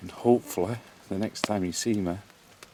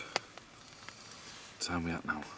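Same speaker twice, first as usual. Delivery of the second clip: whispering; 9 dB quieter, much slower than usual. Real, not acted. Whispering alone in the dark.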